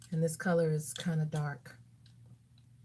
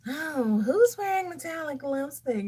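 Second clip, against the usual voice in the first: high, loud, put-on amazement; mock surprised question; 8 semitones higher, 6 dB louder than usual. Vlogger mocking critics of metallic lips, exaggerated high question. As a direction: pitch it way up as if astonished.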